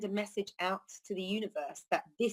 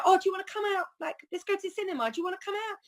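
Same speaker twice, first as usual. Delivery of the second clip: bright, much higher voice, asking a friend out; cheery invitation; 12 semitones higher, faster than usual. Retold or imitated. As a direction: play the eager friend inviting you along. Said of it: "If you said to some, some, someone".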